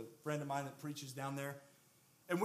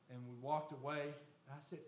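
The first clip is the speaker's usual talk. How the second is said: ordinary talk, nothing marked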